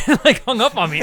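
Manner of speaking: real laugh bursting through the line; laughing loudly. Real, not acted. Laughing as he tells how she hung up on him.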